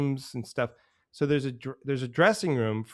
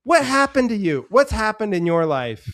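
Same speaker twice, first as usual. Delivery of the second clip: high, loud exaggerated disbelief; mock incredulous; 9 semitones higher, 8 dB louder than usual. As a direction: throw the question at the angsty teens. Mock incredulous audience question.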